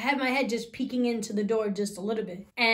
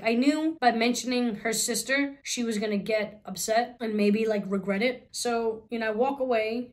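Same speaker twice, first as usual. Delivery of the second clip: ordinary delivery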